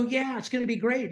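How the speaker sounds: high, eager retelling; naive enthusiasm. Retold or imitated. Young self enthusing to dad.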